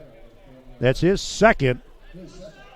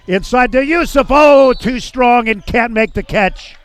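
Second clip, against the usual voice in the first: rising to a higher, louder shout on the pass; excited call; 10 semitones higher, 7 dB louder than usual. Real, not acted. Commentator to radio listeners as the pass sails too strong, in an excited, louder, higher call. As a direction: call the play as it breaks, then groan it away.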